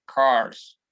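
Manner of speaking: teacher demo of "Cars"; English pronunciation. Shown as a demonstration. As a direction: model "Cars" for the students. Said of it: "solamente le añadimos una S y se vuelve plural".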